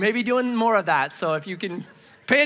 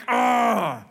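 frustrated ugh; 4 semitones higher than usual. Retold or imitated. Angry and hungry that day.

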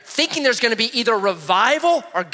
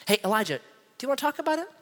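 soft, tentative question rising high; mock gentle concern; 4 semitones higher, 7 dB quieter, faster than usual. Imagined angel checking on depressed Elijah, mock gentle. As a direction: pretend to coax him delicately.

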